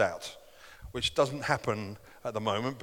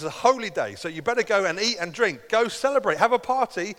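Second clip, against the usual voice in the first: rising excited call; 7 semitones higher, 5 dB louder than usual. Retold or imitated. Nehemiah urging celebration.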